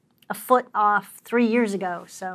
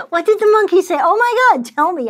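mock excitement; 9 semitones higher, 8 dB louder than usual. Mock eager to hear joke.

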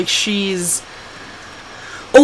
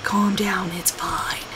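low, hushed, fading to near whisper; calming down; 4 semitones lower, 11 dB quieter, slower than usual. Retold or imitated. Owner telling her needy dog to settle, calming. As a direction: settle them down under your breath. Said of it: "I'm like".

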